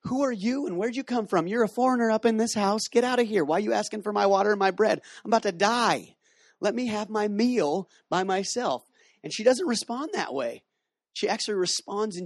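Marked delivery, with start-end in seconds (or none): 0.0-8.8 much higher, fed-up woman shooing a stranger off; indignant widow. Pretend. Pastor imagining the widow, indignant and higher. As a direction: play the widow outraged at the foreign man. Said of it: "if I was a lady, I'd be like".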